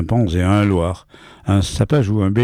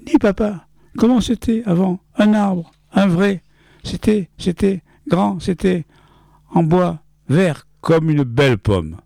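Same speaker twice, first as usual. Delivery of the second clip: put-on high, wide-eyed child asking father; little boy voice; 11 semitones higher than usual. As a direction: play the small son, much higher. Child's voice in story.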